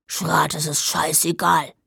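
put-on low grumpy old man's grumble; deep, dismissive grumble; grumbling grandpa. A narrator's reading. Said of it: "grummelte Opa Krause".

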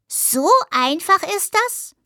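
high, slow, rising wonder; delighted amazement in a high voice; enraptured question. A narrator's reading. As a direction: ask it with drawn-out delight, lifting high. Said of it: "fragte Husch verzückt".

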